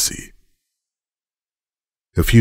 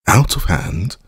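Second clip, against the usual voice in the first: scornful echo, pitched up; disparaging; 6 semitones higher than usual. A narrator's reading. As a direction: throw the words back with disdain. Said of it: "I repeated disparagingly".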